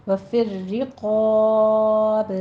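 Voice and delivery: وفی الرقاب slowly, long qaa then bouncing b; firm rr, heavy held qaa in وفی الرقاب; qalqala stop. Shown as a demonstration. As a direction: chant وفی الرقاب, echo the final b. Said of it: "با پہ قلقلہ پیدا".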